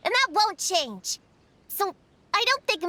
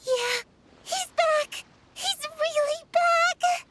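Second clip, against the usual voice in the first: higher, slow and beaming; overjoyed; 9 semitones higher, slower than usual. Acted. Prune to her friends about her returned dog, overjoyed. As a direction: let the joy swell, he's really back.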